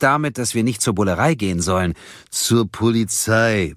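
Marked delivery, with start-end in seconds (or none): 2.3-3.7 slow, pointed correction; drawn-out schoolmaster correction; pedantic interjection. A narrator's reading. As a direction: cut in to correct the word. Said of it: "warf Grung ein".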